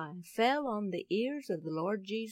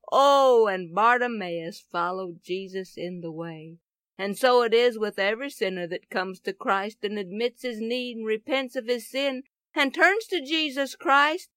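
ordinary talk

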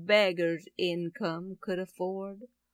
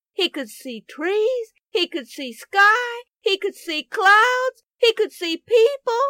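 high, loud, exultant storytelling; excited wonder; 8 semitones higher, 8 dB louder than usual. A narrator's reading. Preacher tells of blind man suddenly seeing, in excited wonder. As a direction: build each new sight like a marvel.

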